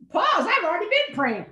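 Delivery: high, lively objection; mock protest. Rebuts her own quoted question.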